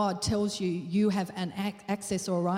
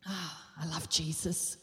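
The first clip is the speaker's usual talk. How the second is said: mock churchy bliss; 4 semitones lower, 4 dB quieter than usual. Churchgoer at Sunday service, soft mock bliss. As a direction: sigh it out like a contented churchgoer. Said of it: "we come to church and we go".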